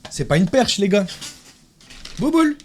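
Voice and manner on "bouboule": pet talk. Real, not acted. Calling his pet cat.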